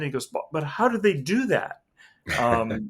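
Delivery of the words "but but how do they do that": pitched up, baffled stammering question; stunned disbelief. Retold or imitated. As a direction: play the stunned neuroscientist asking how. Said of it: "he was just stunned".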